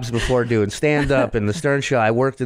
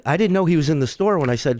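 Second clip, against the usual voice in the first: normal talking voice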